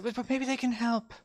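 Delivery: a naive guy hoping for help; pleading guy. Acted. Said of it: "the guy goes like".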